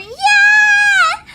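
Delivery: high loud cheer; excited shout. Real, not acted. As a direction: yell it in triumph.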